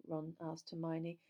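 ordinary delivery

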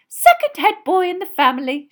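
loud, high proud boast; bursting with motherly pride; swelling with pride. A narrator's reading. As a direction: announce it as a beaming, proud mother. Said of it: "swelling with pride".

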